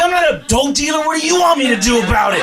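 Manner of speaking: high, fast, loud laughing retort; exasperated wife. Retold or imitated. Wife scolding her stoned husband, exasperated. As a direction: snap back, fed up.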